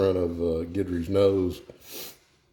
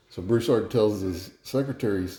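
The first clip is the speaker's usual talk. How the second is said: ordinary delivery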